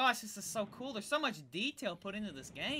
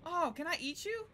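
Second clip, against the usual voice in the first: ordinary talk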